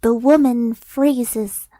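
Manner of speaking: ordinary talk, nothing marked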